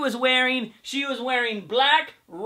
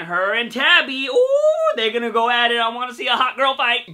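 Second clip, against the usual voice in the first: ordinary talk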